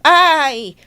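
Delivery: loud, heated motherly scolding; angry ultimatum. Acted. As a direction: lay down a furious threat. Mother threatens to disown her son.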